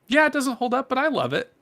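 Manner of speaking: high-pitched imagined admission; unapologetic fan. Acted. Podcaster voicing a fan admitting love for flawed games, high and unapologetic. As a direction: pitch it way up, a cheerful concession.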